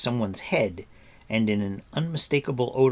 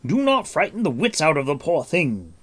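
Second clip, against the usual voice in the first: higher, louder reproving call; 7 semitones higher, 7 dB louder than usual. A narrator's reading. Gwydion defending the creature to Taran, calling out. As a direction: rebuke him from a little way off. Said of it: "Gwydion called".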